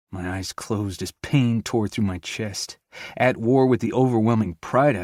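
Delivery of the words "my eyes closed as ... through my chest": ordinary delivery, nothing marked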